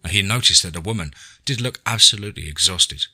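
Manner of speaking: usual speaking voice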